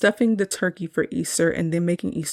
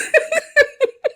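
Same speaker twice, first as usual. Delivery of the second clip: very high, louder burst of laughter; real laugh; 5 dB louder than usual. Real, not acted. After calling the curse hilarious.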